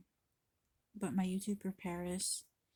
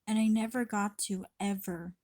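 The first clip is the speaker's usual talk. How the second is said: usual speaking voice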